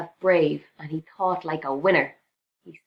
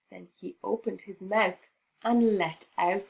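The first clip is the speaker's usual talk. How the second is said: usual speaking voice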